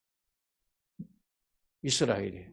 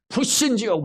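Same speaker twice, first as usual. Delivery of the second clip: angry retort; 8 semitones higher than usual. Retold or imitated. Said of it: "화가 나가지고".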